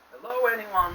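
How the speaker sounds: high, carrying call; crying out. Acted. Lost father calling again through the maze, high and carrying. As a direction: call out hoping someone answers. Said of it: "the voice cried again".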